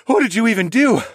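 sigh, then high, loud question; winded, incredulous asking; out of breath. Acted. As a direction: gasp it out after a narrow escape. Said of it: "I finally had the breath".

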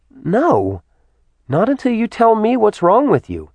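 high, insistent woman's voice; acted exasperated demand; insistent refusal. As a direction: refuse firmly, pitched well up. Woman refuses, quarrelling with him.

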